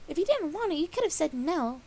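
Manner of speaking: high, loud miffed voice; miffed Pinkie. A narrator's reading. Pinkie scolding Dash for spitting, high and loud. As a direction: shrug off the refusal.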